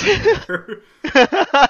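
high, loud, slow wail broken by laughter; hammed-up wounded cry, laughing through it; laughing mock wail. Stung by cohost's joke, a laughing mock wail.

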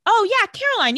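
high, loud, put-on eagerness; mock enthusiasm. Elena drawing Caroline into the conversation, mock enthusiastic. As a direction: chime in with exaggerated friendliness. Models what Elena should say.

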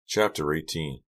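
plain reading voice

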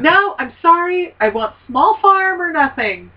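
stubbornly refuse, drawing the words out; indignant activist digging in; Ed Begley Junior. Acted. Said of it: "Mister Begley".

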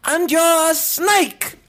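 high, loud, slow hammed-up British accent; British shopkeeper. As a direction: threaten the shoplifter in a goofy British voice. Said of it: "a British man that works at a grocery store".

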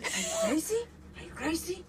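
imitating loud disbelief. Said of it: "He just said".

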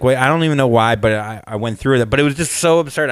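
normal talking voice

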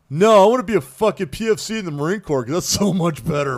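high, loud mocking voice of his son. Father mocking son's choice.

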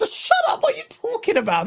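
high, exasperated shout-back; hammed-up exasperation; mock retort. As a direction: snap back in disbelief. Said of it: "He's like".

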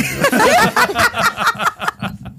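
big high burst of laughter; loud laugh. Real, not acted. Laughing at own joke. (gargalhada alta)

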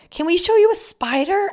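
put-on high, loud eager kid; mock child voice, high; little boy voice. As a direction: ask it like an excited little boy. Kids asking, voiced.

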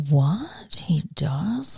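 hushed, stunned whisper; whispered disbelief. Real, not acted. Hearing Ricky mistreats Lucy, a stunned whisper. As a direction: breathe it out in disbelief. Shocked at teacher's claim.

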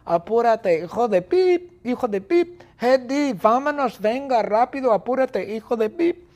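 high, urgent nagging yell; relentless high-pitched impatient shouts; Bertita shouting impatiently. Acted. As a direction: keep hounding a slowpoke to hurry. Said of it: "Bertita grita".

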